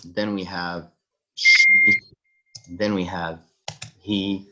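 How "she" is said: she in a very high, loud squeak; mock girl voice on she; high girly voice. Shown as a demonstration. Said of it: "pronoun list, she voiced".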